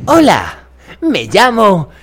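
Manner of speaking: high, loud, hammy dubbing-actor voice; terrible put-on voice. Mocking a dubbing student who talks like this. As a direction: overdo a cheesy announcer voice. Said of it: "empieza a hablar así".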